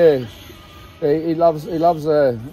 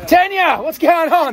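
calling out; 9 semitones higher, slower than usual. Real, not acted. Calling out to a friend.

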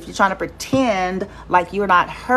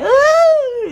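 mock swoon; 5 dB louder than usual. Writer's release on the beach.

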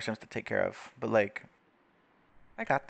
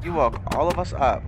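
loud; 3 semitones higher, 6 dB louder than usual. Real, not acted. Confronting noisy neighbours.

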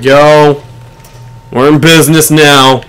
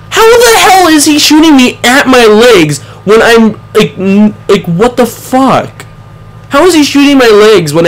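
higher, exasperated complaint, slowing at the end; indignant rant; 5 semitones higher than usual. Real, not acted. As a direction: still fuming over the unfair kill. Still fuming over the kill.

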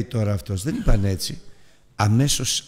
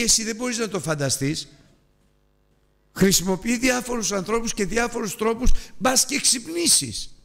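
much higher, slowing on μπας και ξυπνήσεις; impassioned climax; 11 semitones higher than usual. Real, not acted. Preacher peaking emphatically.

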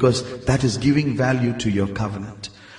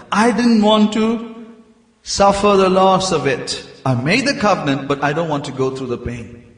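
usual speaking voice

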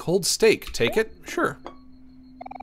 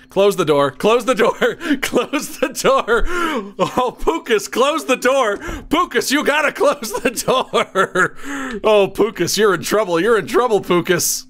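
high, loud pleading with nervous laughs; hammed-up alarm, stretching the repeated warnings; mock panic; 8 semitones higher, 4 dB louder, slower than usual. Player warning Pukas about a stranger at the door, mock panic.

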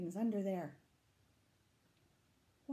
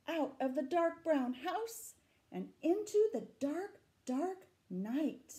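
higher, chase-like narration; reading the chase to children, higher and eager; excited story reading; 9 semitones higher, 7 dB louder, slower than usual. A narrator's reading. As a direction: urge them on after the beast. Reading story text dramatically.

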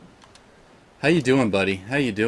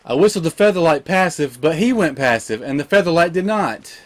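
loud, raised complaint; indignant rant; 5 semitones higher, 5 dB louder than usual. Real, not acted. Gamer ranting to viewers, indignant. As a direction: protest the injustice loudly. Complaining about the pet's death.

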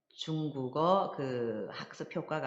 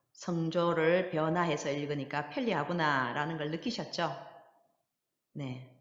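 ordinary talk

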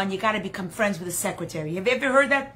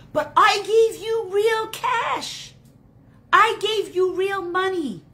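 slow, high, exasperated rebuke; angry; 9 semitones higher, 4 dB louder, slower than usual. Acted. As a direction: stretch each word in acted outrage. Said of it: "but with you I'm angry".

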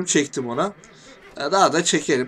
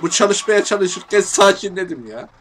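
loud and higher while insisting he's calm; sarcastic loud calm; ironic claim of calm; 5 semitones higher, 7 dB louder than usual. Real, not acted. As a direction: shout that you're calm, clearly not. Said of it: "sakinleştim".

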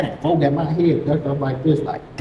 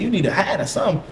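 quick, higher mimicked woman; voicing the woman; 5 semitones higher, faster than usual. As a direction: rattle it off urgently as her. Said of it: "She said".

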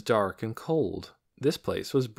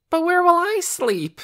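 high, loud wailing question; wailed; 8 dB louder than usual. A narrator's reading. Boy losing his bed, wailing. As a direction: whine it out near tears. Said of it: "Torin wailed".

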